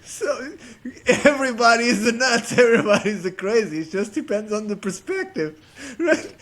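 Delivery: can barely get it out for laughing; laughing to tears. Real, not acted. Storyteller still cracking up at his own punchline, real tearful laughter. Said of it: "I'm crying".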